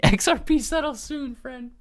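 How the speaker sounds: high-pitched laughing slogan read; silly high voice. Pretend. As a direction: squeak it out through a laugh. Reads chat slogan in silly voice.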